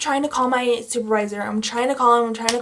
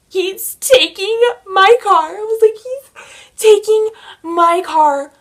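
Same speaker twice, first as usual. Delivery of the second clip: much higher, slow and louder, panicking; panicked wail; 8 semitones higher, 6 dB louder than usual. Retold or imitated. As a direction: wail it as the worst has happened. Officer orders her out of car.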